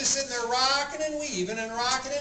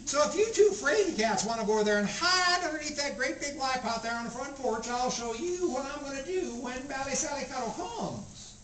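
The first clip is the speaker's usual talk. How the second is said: put-on feisty old Grandma; 5 semitones higher than usual. Grandma snapping back at the children who yelled, high old-woman voice. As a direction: bristle like an offended old lady.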